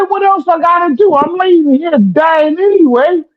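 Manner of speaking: imitated exasperated father, stretched and high; dying father. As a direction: wail it as Dad, pleading up high. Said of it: "He said".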